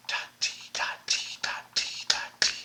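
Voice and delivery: soft whispered ta ti under the students; whispered rhythm. Shown as a demonstration. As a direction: keep time quietly for them. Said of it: "Your turn".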